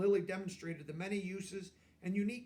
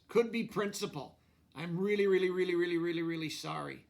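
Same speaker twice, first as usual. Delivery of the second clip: exaggerated apology; 7 dB louder than usual. Lily's note apologizing.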